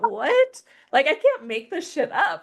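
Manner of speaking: high-pitched disbelieving "what?"; acted-out astonishment, pitched high; incredulous. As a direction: shoot the pitch right up in disbelief. Retells her disbelief at the message.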